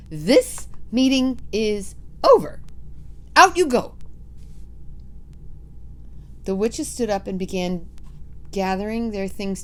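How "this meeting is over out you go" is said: shrill commanding witch. Acted. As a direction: bark it like a witch queen. Grand High Witch orders.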